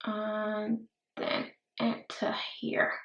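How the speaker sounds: slow, soft breathy whisper. Real, not acted. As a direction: drop to a whisper over the brush. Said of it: "Don't know why I'm speaking like that".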